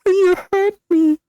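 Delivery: high squeaky mock hurt voice.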